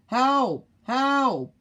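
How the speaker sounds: high, loud cries of help; urgent phone call for help. Acted. Boy calls police for help.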